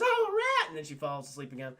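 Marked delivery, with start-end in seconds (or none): 0.0-0.7 high, loud yelp of fright; startled cry. Retold or imitated. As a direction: yelp at the sight of the rat. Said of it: "And she goes".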